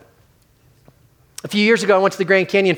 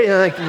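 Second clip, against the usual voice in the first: laughing. Real, not acted. Laughing at his own parody.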